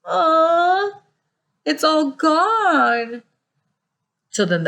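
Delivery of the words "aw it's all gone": little wee bear lament. A narrator's reading. Wee bear laments empty bowl.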